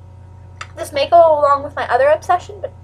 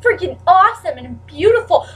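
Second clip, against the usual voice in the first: mock gushing; 7 semitones higher than usual. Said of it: "everybody's like".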